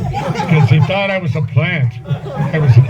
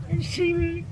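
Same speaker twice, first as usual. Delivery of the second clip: choked up, voice cracks high and much softer; real tears catching the words; voice breaking; 14 dB quieter than usual. Real, not acted. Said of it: "I'm usually not that emotional".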